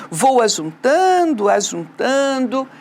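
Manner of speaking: "ajuntando, ajuntando" arrastado e enfático; ênfase na repetição. Shown as a demonstration. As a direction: alongue e enfatize "ajuntando". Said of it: "Aqui é pra dar a ênfase". (repetição enfática e lenta)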